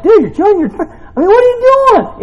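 high and loud, laughing in disbelief; laughing exasperated quote. Retold or imitated. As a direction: scold him while cracking up. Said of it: "I was like".

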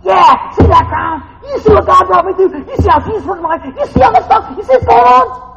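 loud, high, exultant shouting of a saved soul; joyful boast in heaven. Pretend. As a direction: show off the crown, triumphant. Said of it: "It's like".

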